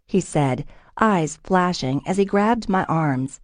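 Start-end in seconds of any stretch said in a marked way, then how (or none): none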